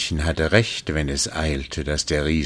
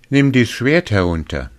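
little man; 7 semitones higher, 5 dB louder than usual. Acted.

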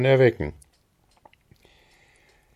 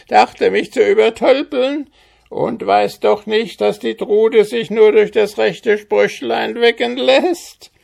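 very high, loud squawking goblin shriek, gloating; schreiender Kobold; 14 semitones higher, 5 dB louder than usual. Acted. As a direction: crow your triumph as a wild goblin dancing about. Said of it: "schrie dabei mit seiner Quäkstimme". (sehr hohes, lautes, triumphierendes Koboldgekreische)

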